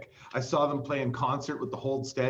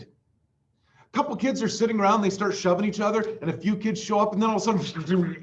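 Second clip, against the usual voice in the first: high, lively storytelling; excited; 6 semitones higher than usual. Real, not acted. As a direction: tell it excitedly. Excited retelling.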